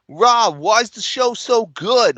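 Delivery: put-on high, slow Brooklyn accent. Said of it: "Brooklyn accents".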